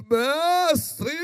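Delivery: high, drawn-out mock-pious call; religious intoned voice. Law expert calling for Jesus' attention before the crowd, pompous intoned hail. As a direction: hail the teacher in a swelling churchy tone. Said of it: "voz de religioso, quando entonado, muda".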